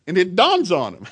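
usual speaking voice

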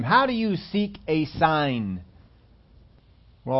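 ordinary talk

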